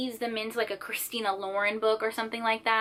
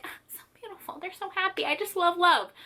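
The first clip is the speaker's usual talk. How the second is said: mock weepy; 8 semitones higher than usual. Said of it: "crying 'cause I'm like".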